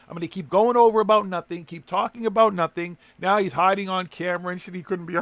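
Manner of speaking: loud, high, fired-up rant; heated rant. Real, not acted.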